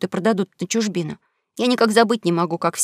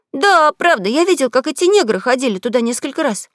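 put-on higher, eager boy protesting; pleading young boy, pitched up; boy's voice; 5 semitones higher, 4 dB louder than usual. Huck's made-up confession.

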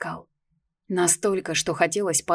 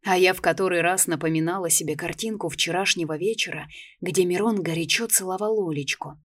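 ordinary delivery

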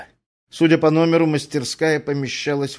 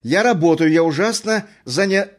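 raised, hurried and impatient; 5 semitones higher, 4 dB louder than usual. A narrator's reading. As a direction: brush him off, busy at work. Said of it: "её нетерпеливый голос".

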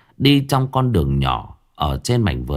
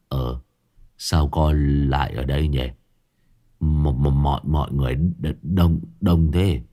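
groggy, just awake; 5 semitones lower than usual. A narrator's reading. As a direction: mumble haltingly as if dragged out of sleep. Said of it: "Mặt mũi nó ngơ ngác". (giọng ngái ngủ, lờ đờ)

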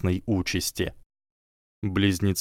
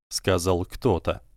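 plain reading voice

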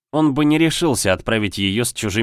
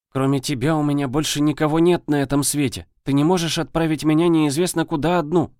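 put-on upset girl voice; 6 semitones higher than usual. Girl pleading with brother.

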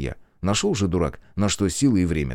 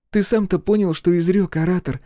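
high question with a smile; amused surprise; 11 semitones higher than usual. A narrator's reading. Girl questions the muddled orator, amused surprise. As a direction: ask it high, smiling in wonder. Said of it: "с удивлённой улыбкой спросила".

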